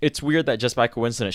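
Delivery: usual speaking voice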